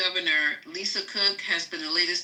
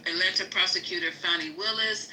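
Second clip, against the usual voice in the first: ordinary delivery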